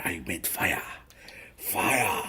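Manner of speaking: proud hushed "I made fire"; Castaway awe. Retold or imitated. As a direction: savour "fire" in quiet wonder. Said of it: "that's from the movie Castaway".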